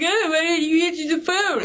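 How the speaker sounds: high, whiny mock complaint; mock nagging. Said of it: "people are like".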